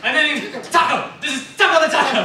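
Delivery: high, loud, eager; excited show-off. Retold or imitated. The character showing friends his octopus pet, high and loud. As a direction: show off the new pet giddily.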